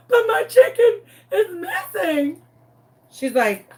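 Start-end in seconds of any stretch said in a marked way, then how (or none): 0.1-2.4 high, slow, tearful whine; hammed-up crying. Pleading with busy Kat on the phone, mock crying. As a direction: protest through mock sobs. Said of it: "I am crying my eyes out, crying".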